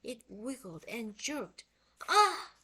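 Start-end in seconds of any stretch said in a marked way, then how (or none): 2.1-2.5 sudden high startled scream; short frightened shriek. A narrator's reading. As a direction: yelp out in fright. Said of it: "Jack screamed".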